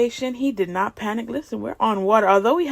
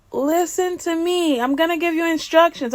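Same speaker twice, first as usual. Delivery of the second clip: higher-pitched mock instructor voice; imitating the instructor; 6 semitones higher than usual. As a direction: play an insistent instructor, pitched up. Retold instructor giving orders.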